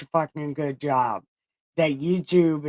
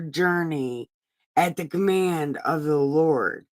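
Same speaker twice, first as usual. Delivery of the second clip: scripture reading; 2 semitones higher, 4 dB louder than usual. Acted. Streamer reads Numbers aloud in a solemn reading voice. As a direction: read it like a lector in church.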